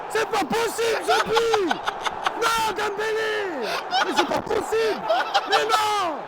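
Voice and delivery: high, laughing yells of disbelief; laughing shout. Real, not acted. Laughing in disbelief after goal.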